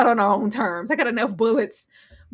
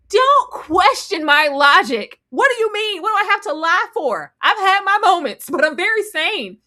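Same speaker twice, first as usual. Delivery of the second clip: put-on indignant Boyd; 8 semitones higher, 5 dB louder than usual. Boyd defending himself, voiced.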